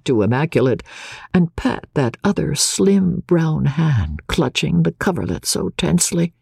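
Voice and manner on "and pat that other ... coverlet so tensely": ordinary delivery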